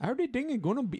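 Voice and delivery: put-on high-pitched, accidentally Indian accent; singsong Indian-sounding accent, badly faked; botched Canadian accent sounding Indian. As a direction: attempt Canadian, slide into Indian. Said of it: "No, that is super Indian".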